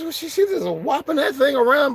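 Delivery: very high, excited put-on voice; high squealing commentator; high mock hype voice. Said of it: "I says".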